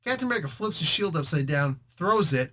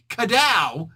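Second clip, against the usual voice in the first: Kadow punched louder and higher; loud sound effect; 5 semitones higher, 5 dB louder than usual. Shown as a demonstration. Narrator settles on the sound effect, louder Kadow!. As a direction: belt out Kadow!.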